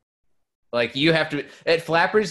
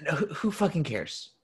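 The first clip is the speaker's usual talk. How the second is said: dismissive; 5 semitones higher, 6 dB quieter than usual. Acted. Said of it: "Would you say it like that?".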